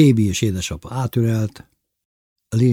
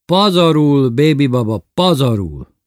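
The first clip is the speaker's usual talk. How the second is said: loud, raised exclamation that settles on the repeat; emphatic praise; 6 semitones higher, 6 dB louder than usual. A narrator's reading. Lili confirming Bébi's cooking talk, emphatically. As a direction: sing his praises, first word highest.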